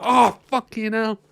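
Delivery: high, slow hammed-up ouch; lightly voiced pained gangster; mock pained cry. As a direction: play up the hurt hand. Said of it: "and he goes".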